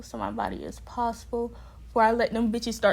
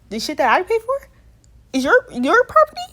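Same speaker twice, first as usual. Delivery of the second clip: loud, sharply rising disbelief; incredulous outrage; 8 semitones higher, 9 dB louder than usual. Retold or imitated. Resident challenges staff claim on their belongings, incredulous and loud. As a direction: throw it back as a high, indignant question.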